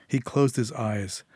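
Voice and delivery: plain reading voice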